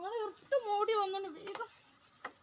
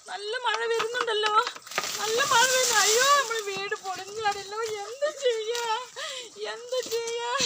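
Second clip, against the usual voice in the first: loud, frantic wailing about the rain; panicked cries; 9 dB louder than usual. Acted. Mother fearing the storm will wreck her house, loud panicked wail. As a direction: cry out in alarm, much louder.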